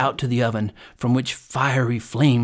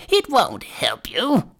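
high, drawn-out crone voice; old hag; 8 semitones higher, slower than usual. A narrator's reading. Hag dismisses Gretel's pleas, crone voice. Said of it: "said the old hag".

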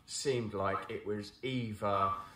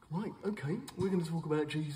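higher, hammed-up eager pastor; goofy trendy preacher; 7 semitones higher, faster than usual. Brand mocking modern church in a goofy voice. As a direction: play the keen modern vicar for laughs. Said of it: "the goofy kind of maybe Southern American".

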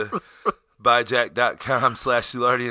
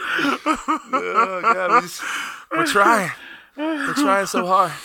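laughing plea; 7 semitones higher, much slower than usual. Real, not acted. Pleading for donations.

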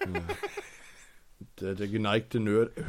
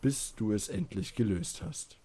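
ordinary talk, nothing marked

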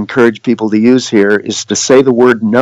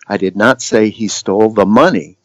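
"money" emphasized at the end, then falling; stress on MONEY. Shown as a demonstration. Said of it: "the different emphasis".

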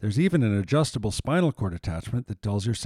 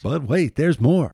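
louder, rising hard-sell hype; excited pitch; 5 semitones higher, 6 dB louder than usual. Acted. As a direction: pump up the big reveal. Infomercial hard-sell moment.

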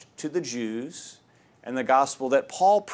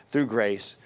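usual speaking voice

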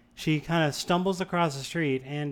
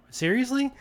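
high, disbelieving seriously with a laugh; incredulous; 10 semitones higher than usual. Retold or imitated. Man calling after the truck of kids, high and incredulous.

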